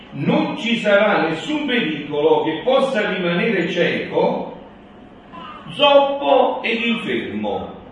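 loud, ringing proclamation, slow in places; town crier. Acted. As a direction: announce it to the whole square. Reads prophecy loudly.

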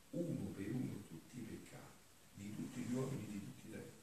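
normal talking voice

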